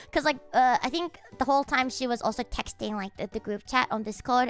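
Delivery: ordinary talk, nothing marked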